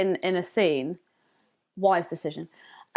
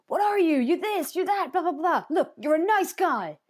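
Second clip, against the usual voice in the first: higher, mocking take on the actor's tirade; half-hammed scolding voice; mock ranting boss; 6 semitones higher than usual. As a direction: parody the backhanded nice-guy firing. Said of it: "And he's just like".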